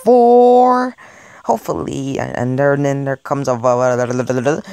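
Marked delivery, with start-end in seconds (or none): 0.0-1.0 louder, higher punch on the number; sudden loud burst on the number; loud reveal. Acted. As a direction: announce it like a punchline. Counting reveal.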